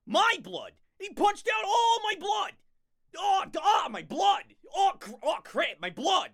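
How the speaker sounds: loud, high hammed-up panic; mock despairing shouts. Mock despair over blood.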